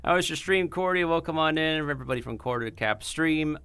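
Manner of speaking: high cheerful streamer welcome; cute anime streamer. Acted. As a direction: greet the raiders brightly.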